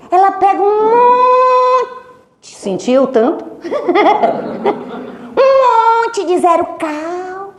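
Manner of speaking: real laughter at grandma's trick; laughing. Real, not acted. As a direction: laugh out the punchline. Laughing punchline.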